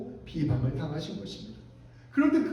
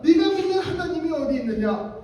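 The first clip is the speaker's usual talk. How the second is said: loud, raised imitation of a scoffer; mocking challenge; 7 semitones higher, 6 dB louder than usual. Scoffer demanding believers show God's salvation, mocking challenge. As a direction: throw it out as a high, loud taunt. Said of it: "조롱한다면".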